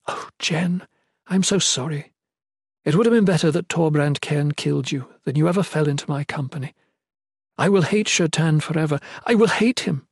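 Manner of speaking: grieving noblewoman, growing resentment; sorrowful queen, turning bitter. Acted.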